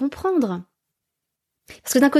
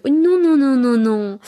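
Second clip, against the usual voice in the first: high, playful string of nos; cutesy singsong no; 4 semitones higher, 4 dB louder than usual. Shown as a demonstration. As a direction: tease it like a baby game. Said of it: "ces petits jeux de".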